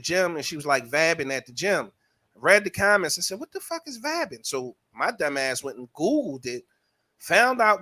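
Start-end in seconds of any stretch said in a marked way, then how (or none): 3.4-4.4 high, quick baffled question; baffled retold question. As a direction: retell being puzzled, pitched way up. Retells baffled reaction to comments.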